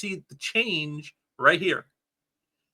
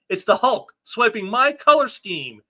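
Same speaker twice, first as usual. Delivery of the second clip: high, loud, slow villain outrage; outraged Rhino; 6 semitones higher, 5 dB louder than usual. Acted. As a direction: bellow it like an offended brute. Rhino's outraged comic line.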